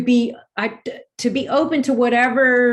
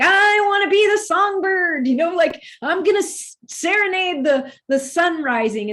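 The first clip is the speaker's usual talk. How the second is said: much higher, bright sing-song wish; playful songbird; 8 semitones higher than usual. Retold or imitated. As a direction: lift the voice like a bird greeting dawn.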